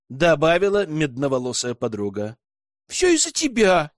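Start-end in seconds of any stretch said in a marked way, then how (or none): 2.9-3.9 much higher, louder accusing blurt. A narrator's reading. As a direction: fire it straight at her. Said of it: "выпалила блондинка".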